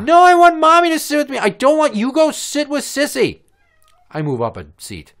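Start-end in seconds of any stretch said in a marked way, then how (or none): 0.0-3.4 put-on loud high wailing child voice; much higher and louder crying kid. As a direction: wail it like a sobbing toddler. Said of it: "She starts crying".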